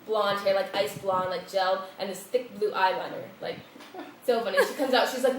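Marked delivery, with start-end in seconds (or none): none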